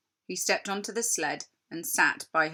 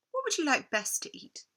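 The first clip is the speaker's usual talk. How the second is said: higher, sweet tempting queen; 8 semitones higher than usual. Acted. Queen offers Edmund any food he wants, high and sweet. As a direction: lure him in.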